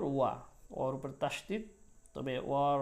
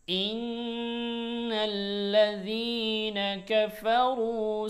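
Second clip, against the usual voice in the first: Quran recitation; 3 semitones higher than usual. Shown as a demonstration. Teacher reciting the verses for students. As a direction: chant the verses with long held notes. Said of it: "এই আয়াতটা আবার একবার বলছি".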